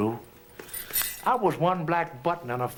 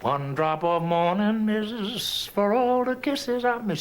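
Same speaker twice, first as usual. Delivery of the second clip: slow, higher, lingering lines; slow lament; 5 semitones higher, slower than usual. Acted. Boy mourning his missed kisses, slow and lingering. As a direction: draw each drop out slowly.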